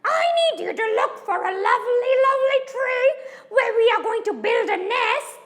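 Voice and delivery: high, fast bird-character voice; Missus Crow. Acted. Crow wife instructing her husband about nesting, high quick voice. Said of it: "Missus Crow now told Mister Crow".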